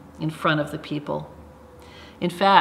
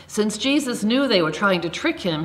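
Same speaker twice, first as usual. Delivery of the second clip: ordinary talk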